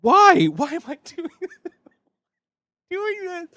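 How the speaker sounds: high-pitched wail breaking into laughter; despairing laughter. Real, not acted. Host regretting the tarantula challenge, despairing laughter. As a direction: cry out, then collapse into helpless laughing.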